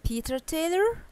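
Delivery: Taylor lifted high at the end; rising question. Shown as a demonstration. Teacher showing a question by its rise. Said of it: "Here it's a question".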